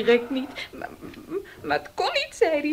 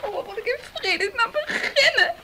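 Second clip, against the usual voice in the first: higher, tearful pleading; distressed crying; 7 semitones higher than usual. Acted. Daughter crying to her father in despair. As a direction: sob out your despair to your father.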